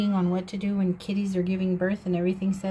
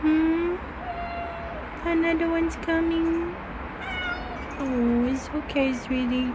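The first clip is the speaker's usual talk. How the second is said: soothing cat talk; 7 semitones higher than usual. Real, not acted. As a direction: coo gently to the laboring cat. Soothing a cat giving birth.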